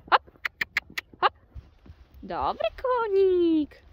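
high, loud calls and drawn-out praise; loud urging. Real, not acted. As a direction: cheer the horse on from the middle. Urging horse to gallop. (głośne nawoływanie konia)

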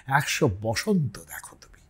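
soft whispered blessing. Shown as a demonstration. Teacher whispering the blessing to his listeners. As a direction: breathe the blessing out.

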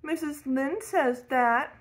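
put-on mild childish protest. A narrator's reading. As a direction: defend yourself like a wronged boy. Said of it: "protested Davy".

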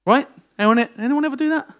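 ordinary talk

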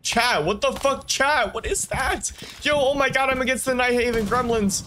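high, stunned outburst at the enemy; shocked disbelief. Real, not acted.

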